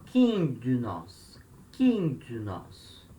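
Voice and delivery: very slow, syllables drawn out; careful, stretched model pronunciation; slow dictation. Shown as a demonstration. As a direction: sound the word out slowly for learners. Teacher models a Greek vocabulary word.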